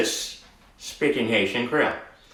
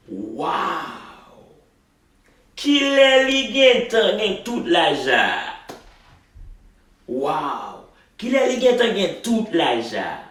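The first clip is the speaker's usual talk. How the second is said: amazed; 8 semitones higher, slower than usual. Acted. Amazed mother in the dialogue.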